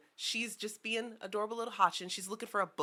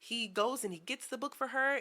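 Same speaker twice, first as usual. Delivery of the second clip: normal talking voice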